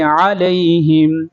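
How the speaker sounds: انعمت علیہم with a throaty ع; sounded ain. Shown as a demonstration. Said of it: "عین کی آواز نکالیں گے،".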